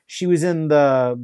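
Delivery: ordinary talk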